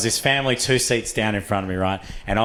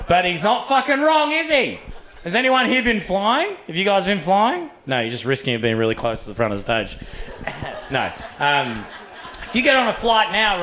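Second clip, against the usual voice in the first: ordinary delivery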